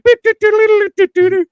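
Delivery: high, loud beep-doo car sounds; imitated car noise. Cousin mimicking the car as they drive off, high beeping. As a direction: sing out beeps like a toy car. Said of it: "And he's like".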